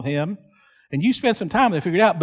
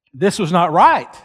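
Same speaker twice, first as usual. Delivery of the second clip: normal talking voice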